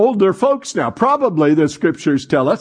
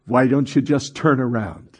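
low, gentle reply; kindly, lower-pitched coaxing; patient and kind; 5 semitones lower, 3 dB quieter than usual. Retold or imitated. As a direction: answer a complainer with quiet patience. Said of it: "looks patiently and kindly and says".

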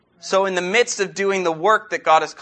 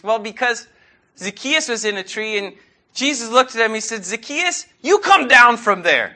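high, earnest little girl explaining, lightly voiced; 7 semitones higher than usual. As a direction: play the small daughter retelling the story, a touch laughing. Daughter's childhood words retold.

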